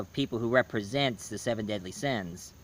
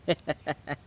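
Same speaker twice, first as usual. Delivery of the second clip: quick amused laugh; short laugh; 4 dB quieter than usual. Real, not acted. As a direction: laugh at your own bit. Laughs after the impression.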